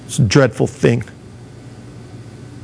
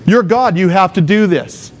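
higher-pitched, self-satisfied listing of merits; entitled demand; 7 semitones higher than usual. Acted. A believer demanding God's kingdom for good behavior, mock entitled. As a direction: press your claim as if it is owed. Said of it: "demand his entire kingdom".

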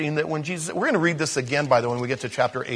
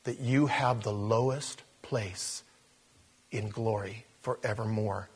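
ordinary talk, nothing marked

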